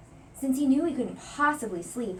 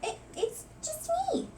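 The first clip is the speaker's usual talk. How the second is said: timid small voice. A narrator's reading. Timid hidden mouse answers.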